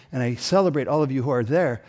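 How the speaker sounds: normal talking voice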